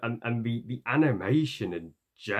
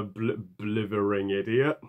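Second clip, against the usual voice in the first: normal talking voice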